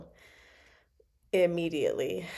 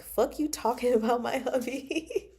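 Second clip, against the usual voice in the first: laughing mock retort; 4 semitones higher, 3 dB quieter than usual. Mock retort in character's place.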